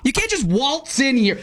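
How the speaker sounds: scolding someone barging in; hammed-up scold. Cohost acting out the saying, slow mock scold.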